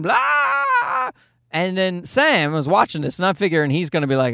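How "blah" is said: imitated scream. Dad imitates the visiting girl screaming down the hallway, playful high shriek. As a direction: mimic a little kid shrieking while running.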